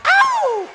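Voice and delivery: very high, loud "ow"; piercing celebratory yelp; gospel whoop. Real, not acted. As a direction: let out a high whoop mid-song. Whoop while singing worship song.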